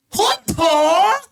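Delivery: high-pitched mock Australian yell; shouted Australian impression. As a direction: blurt it out as an imitated shout. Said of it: "this is me quoting the Australian".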